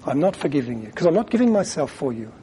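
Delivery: ordinary talk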